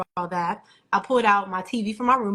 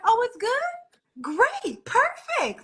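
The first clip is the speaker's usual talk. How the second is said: higher, chirpy delight; bubbly customer-service cheer; happy agent; much slower than usual. Retold or imitated. Vizio agent cheerfully relieved the TV is fine, chirpy and high. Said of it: "she was so happy for me".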